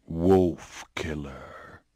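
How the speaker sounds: very slow, low whisper; eerie creature whisper. Acted. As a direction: draw it out like a summons from the dark.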